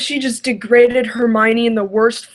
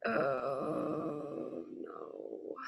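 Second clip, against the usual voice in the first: despairing groan; 8 semitones lower, 22 dB quieter than usual. Real, not acted. Despairing at the fanfic.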